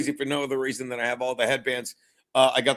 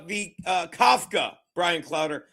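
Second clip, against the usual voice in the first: normal talking voice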